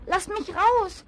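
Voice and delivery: high, loud panicked cry to be let out; a trapped little piglet screaming for help; shrill, loud, frantic child's plea; desperate scream. A narrator's reading. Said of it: "schrie Tommy verzweifelt".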